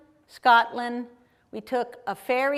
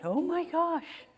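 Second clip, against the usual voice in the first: high, breathless-to-hurry servant act; mock-panicked servant, higher than usual; flustered servant; 6 semitones higher than usual. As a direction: play a servant in a fluster, pitched up. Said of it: "[name]'s acting like the servant".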